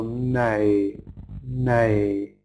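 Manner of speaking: "nay" drawn out and held on its own; stressed first syllable. Shown as a demonstration. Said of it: "it's stressed on the first syllable".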